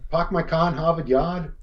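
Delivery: mock Boston with dropped r's; Boston accent. Shown as a demonstration. Co-host proving it's Boston, mock Boston accent. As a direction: drop every r like a Bostonian. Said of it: "No, that's Boston".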